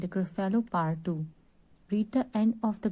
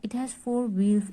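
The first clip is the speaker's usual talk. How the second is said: ordinary talk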